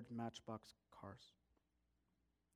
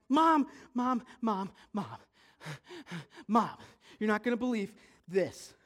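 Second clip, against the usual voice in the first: put-on loud, higher kid pestering; urgent calling; 6 semitones higher, 10 dB louder than usual. Boy running in to his mom, urgent calling. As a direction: call out over and over for attention.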